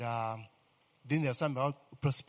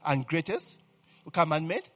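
loud, rising sermon delivery over church music; full-force preaching; 3 semitones higher, 6 dB louder than usual. Acted. Pastor preaching to his congregation, full-force preaching.

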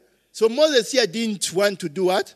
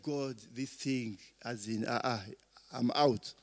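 quiet retold prayer; 8 semitones lower, 12 dB quieter than usual. Preacher retelling his own prayer.

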